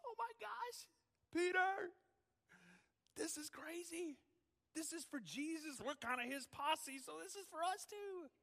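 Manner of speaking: high, wondering cries of amazement; a disciple marvelling to his friends; awed disciple. Acted. Disciples amazed at the triumphal entry, high and excited.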